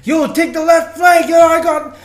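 shouted high squad callout; grenade warning yell. Acted. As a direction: yell the callout over gunfire. Acting out Warzone teammate callout.

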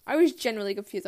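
usual speaking voice

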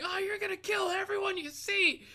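very high, loud imitated scream; drill sergeant. Other drill sergeant piling on the recruit, screamed. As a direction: yell it full force like a second sergeant piling on. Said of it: "this other drill sergeant".

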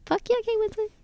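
high, excited cheer for Kate Winslet; high fangirl praise. Retold or imitated. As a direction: squeal your approval, way up. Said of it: "And I was like".

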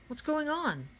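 high-pitched, baffled question; alarmed cry. A narrator's reading. Miguel at the stalled machine, crying out in high-pitched confusion. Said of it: "cried Miguel".

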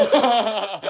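laughing loudly while telling it. Real, not acted. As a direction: crack up at the memory. Laughing at the memory.

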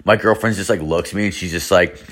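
usual speaking voice

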